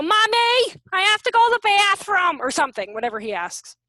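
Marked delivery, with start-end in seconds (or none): 0.0-2.4 put-on higher, louder child's plea; kid's whine. Son interrupting mom's call, higher childlike plea. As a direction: play the kid asking mommy. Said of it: "so he can not go".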